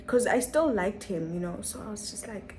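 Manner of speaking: ordinary talk, nothing marked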